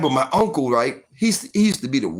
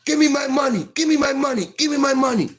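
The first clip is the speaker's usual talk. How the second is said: uncle's threat; 11 semitones higher, faster than usual. Retold or imitated. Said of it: "put the gun to my head".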